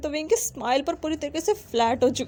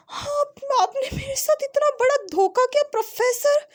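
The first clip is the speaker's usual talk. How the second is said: much higher, louder cry of betrayal; wide-eyed shock; 13 semitones higher, 3 dB louder than usual. A narrator's reading. Student accusing his professor of tricking him, high and wounded. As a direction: squeal it like a betrayed kid, half playful. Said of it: "आंखें फाड़ कर देख रहा था और कहता".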